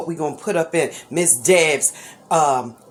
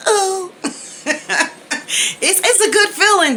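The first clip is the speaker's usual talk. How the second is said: high giddy laugh through the words; emotional laughing, real; 13 semitones higher than usual. Real, not acted. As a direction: laugh it out, overwhelmed with joy. Overcome thanking her viewers.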